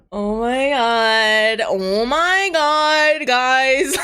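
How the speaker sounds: high, loud, drawn-out disbelief; shocked shout. Acted. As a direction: stretch out each oh my god in loud shock.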